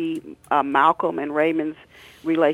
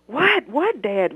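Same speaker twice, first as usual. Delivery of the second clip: high, frightened cry; scared scream; 8 semitones higher, 3 dB louder, slower than usual. Acted. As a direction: cry out, afraid of the father. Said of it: "Raymond screamed".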